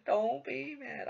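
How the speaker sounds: "Don't be mad." high and slow, laugh gone; a teasing, sing-song reassurance; mock soothing. Teasing a salty opponent again, mock soothing.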